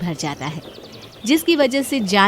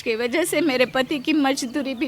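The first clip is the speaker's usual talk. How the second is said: anxious maid begging to keep her job; distressed, imploring servant; pleading maid; 6 semitones higher than usual. Acted. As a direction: plead with your employer, ending higher.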